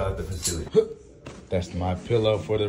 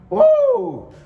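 loud high whoop of excitement; excited whoop; 11 dB louder than usual. Real, not acted. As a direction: let out a big happy whoop. Hyping up her race events.

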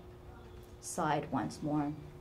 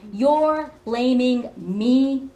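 very slow, much higher and louder, wailing; rising accusation; 7 semitones higher, 13 dB louder, much slower than usual. Acted. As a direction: push the accusation into a wail. Mother accuses daughter.

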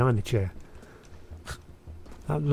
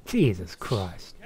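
exasperated; 6 semitones lower, 5 dB quieter, much slower than usual. Real, not acted. Startled by teammate.

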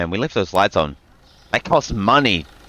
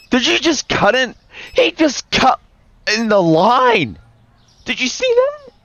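much higher, loud outraged yelp; indignant; 13 semitones higher, 4 dB louder than usual. Real, not acted. Shopkeeper watching a customer cut in line, high outraged yelp. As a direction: call out the line cutter in shock.

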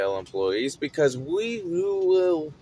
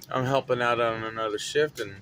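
ordinary talk

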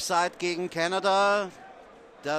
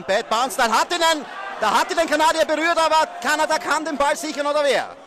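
excited shout; 9 semitones higher, 8 dB louder than usual. Real, not acted. Chaotic punt play called live.

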